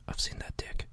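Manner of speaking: unvoiced whisper, conspiratorial; hushed secret whisper. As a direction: breathe it out as a secret. Whispered retort in a pretend scene.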